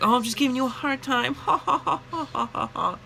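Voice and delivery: high, quick teasing laugh as the woman; mocking customer laugh. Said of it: "And she's like".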